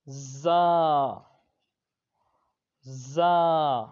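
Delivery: زا loud with a hissing whistle; whistling za. Shown as a demonstration. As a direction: let زا whistle through the teeth. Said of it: "اس میں سیٹی کی آواز آئے گی". (زا سیٹی کی آواز کے ساتھ)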